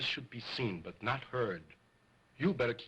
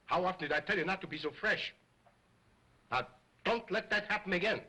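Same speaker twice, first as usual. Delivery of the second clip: stern telling-off, higher; scolding; 6 semitones higher, faster than usual. Acted. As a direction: scold the child firmly. Scolding the cheeky dummy.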